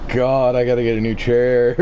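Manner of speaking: normal talking voice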